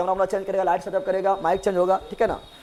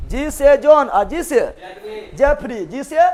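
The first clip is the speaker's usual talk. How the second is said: ordinary talk, nothing marked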